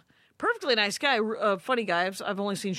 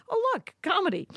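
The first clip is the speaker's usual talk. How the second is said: high, mock-delighted aside; dry sarcastic quip; 10 semitones higher than usual. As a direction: point it out high with fake glee. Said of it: "Maria going".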